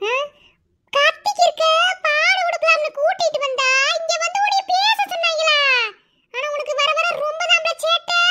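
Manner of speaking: high, loud telling-off; scolding shout. Acted. Woman scolding someone for their mischief, shouting high and loud. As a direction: shout the scolding at the cheeky one.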